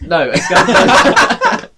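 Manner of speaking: words broken by real laughter; laughing. Real, not acted. Speaker corrects himself while cracking up at the joke. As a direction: try to say it through the giggles.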